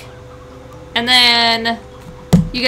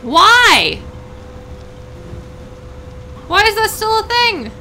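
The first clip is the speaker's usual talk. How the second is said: exasperated; 11 semitones higher, 4 dB louder, slower than usual. Real, not acted. Storm still going.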